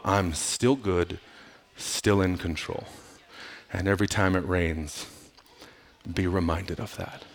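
God's quiet promise to Noah, softly; gentle reassurance. Acted. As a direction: hush it like a comforting promise.

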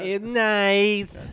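put-on hammy foreign accent voice; Borat impression. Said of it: "That's really what he's doing".

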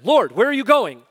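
loud, high anxious question; urgent plea to the Lord; concerned Peter. Retold or imitated. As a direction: call out in worry as Peter. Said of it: "He is so concerned".

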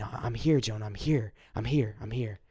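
quietly reassure, fading away; soft reassurance. Retold or imitated. God telling Jonah he is near, softly hushed.

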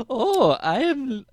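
a hammed-up German accent. Shown as a demonstration. Mocking Merritt's fancy act in a German accent. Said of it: "I was doing German".

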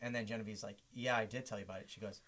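low, quiet, lightly voiced clueless designer; mock designer. Host quietly imitating the clueless designer's excuses. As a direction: mumble it like she doesn't care.

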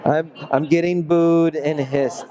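sheepish laugh through the words; laughing at himself. Real, not acted. Preacher laughing after calling listeners old people. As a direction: laugh off the slip. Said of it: "I messed up".

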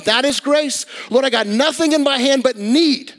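high-pitched, emphatic pulpit delivery; impassioned preaching. Real, not acted. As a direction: preach it with fervour, pitch held up. Preaching at raised intensity.

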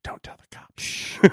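slow hushed whisper. Real, not acted. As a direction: whisper as if plotting. Joking secret about beer.

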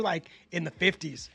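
ordinary talk

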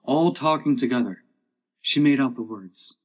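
ordinary delivery

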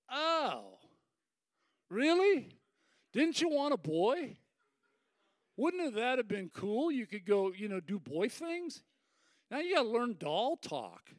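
high, loud, tactless questioning; mock doubtful surprise. Passerby answering new dad about a girl, mock doubtful. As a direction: play a let-down well-wisher. Passerby dampens the father's joy.

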